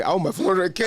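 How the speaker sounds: to the host, mocking his friend's greed, laughing. Laughing through the mock quote.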